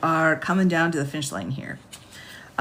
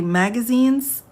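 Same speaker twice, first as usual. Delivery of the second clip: higher, slow, stunned question on Magazines?; Magazines? drawn out as a baffled double take; incredulous; 5 semitones higher, 4 dB louder, slower than usual. Real, not acted. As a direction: stop the reading and ask Magazines? in disbelief. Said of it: "Hold on, everybody".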